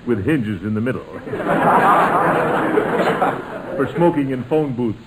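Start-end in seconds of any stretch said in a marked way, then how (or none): 1.1-3.7 a long rolling chuckle, pleased with himself. Real, not acted. Uncle laughing at his own cigar joke, rolling chuckle. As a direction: laugh at your own joke, loud and drawn out.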